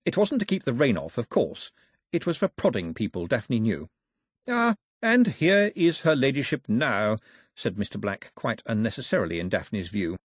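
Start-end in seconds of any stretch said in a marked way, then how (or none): 4.5-7.2 slow, higher-pitched courtly announcement; announcing official. Acted. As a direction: announce her arrival slowly and formally. Official announces grandmother's arrival.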